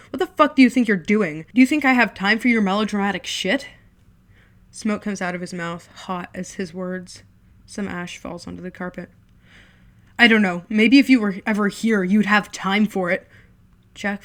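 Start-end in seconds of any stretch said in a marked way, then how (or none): 0.1-3.7 loud scornful shout; older brother yelling at melodramatic kid; angry yelling. Acted. 10.2-13.3 loud bitter yell; shouting blame at an absent brother; angry shout. Acted. Jack shouting back.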